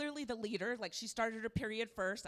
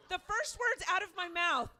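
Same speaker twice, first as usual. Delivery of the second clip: ordinary delivery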